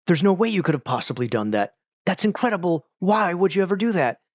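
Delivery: imitated melodramatic astonishment; mock dramatic disbelief. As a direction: overact shocked disbelief, voice climbing. Acting out cheesy drama dialogue.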